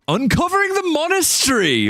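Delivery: very high, hammed-up title cry; theatrical announcement. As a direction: proclaim the title in a soaring mock-dramatic voice. Hammed-up reading of a book title.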